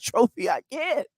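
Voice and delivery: ordinary talk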